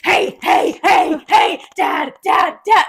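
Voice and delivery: high, loud, rapid-fire calls; pestering kid shouts. Retold or imitated. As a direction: badger Dad like an annoying child. Child self pestering Dad again.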